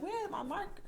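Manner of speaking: high, faint mocking teacher voice; mock teacher asking high and soft; mimicking teacher. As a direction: squeak it out like the confused teacher. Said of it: "She'd be like".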